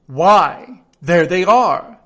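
loud, triumphant king; the king crowing in triumph, loud. Acted. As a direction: crow it out as if you've proved it. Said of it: "said the king triumphantly".

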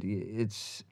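slow, soft, bad Australian accent; halting mock Australian words of wisdom; Sam Neill. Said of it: "This is a horrible Australian accent".

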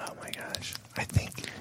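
very soft whisper. Acted. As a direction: barely breathe the words out. Hosts drop into whispers.

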